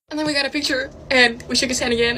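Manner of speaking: usual speaking voice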